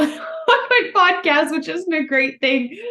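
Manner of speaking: laughing, high and loud. Real, not acted. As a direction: laugh through the words. Laughs at making listeners yawn.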